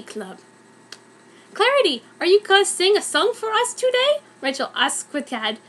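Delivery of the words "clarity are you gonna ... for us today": eager. Acted. Rachel asks eagerly.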